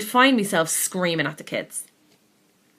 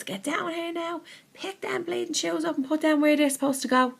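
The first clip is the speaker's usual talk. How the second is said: high-pitched mock yell at the kids; imitated shout at kids; 11 semitones higher, 4 dB quieter than usual. Parent calling the kids down to pick up shoes, mock shouting. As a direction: bark the order up the stairs, half-acted. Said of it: "you start to shout at the kids".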